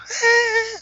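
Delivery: very high squeaked whistle-note attempt; attempted pop diva dog whistle; failed dog-whistle note. Shown as a demonstration. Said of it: "Does she do the dog whistle thing?".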